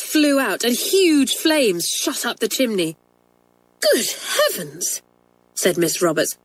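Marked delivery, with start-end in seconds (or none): 3.8-5.0 higher, slow shocked cry; jolted by the blast; teacher startled by exploding fire, shocked; startled exclamation. A narrator's reading.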